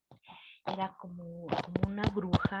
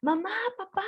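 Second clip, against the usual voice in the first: higher, half-mock childlike yell; retold cry for parents; 10 semitones higher than usual. Little girl trapped by the witch, crying for her parents, mock yell. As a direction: call out for your parents, pitched up high. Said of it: "y yo gritaba".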